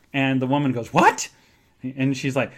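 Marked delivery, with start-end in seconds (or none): 0.9-1.3 very high, louder shocked yelp; shocked what. Retold or imitated. Receptionist hearing the ring story, shocked yelp. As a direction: yelp it in disbelief.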